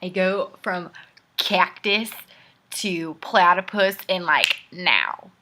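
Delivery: loud, hammed-up boast; mock-serious loud brag; mock bragging. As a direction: imitate someone boasting absurdly, dropping at the end. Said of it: "that's what it sounds like when people say".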